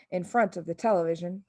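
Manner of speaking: plain reading voice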